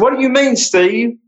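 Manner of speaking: high, alarmed voice asking why; shocked question. Retold or imitated. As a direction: sound caught off guard. Retold shocked reply to banker.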